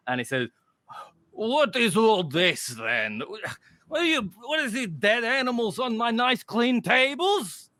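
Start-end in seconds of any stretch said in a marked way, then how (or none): 1.3-7.7 a fussy innkeeper scolding the party; gruff barkeep. Acted. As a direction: barkeep huffing at dead animals on his tables. GM voices grumpy barkeep.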